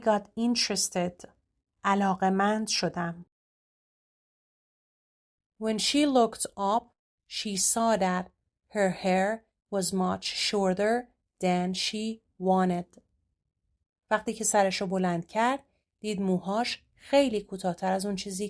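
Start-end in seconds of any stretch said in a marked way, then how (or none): 5.6-13.0 slow, soft storybook read; story reading. Acted. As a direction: read it gently like a picture story. Reads story sentence aloud.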